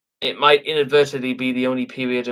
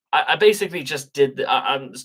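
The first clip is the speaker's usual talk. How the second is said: ordinary delivery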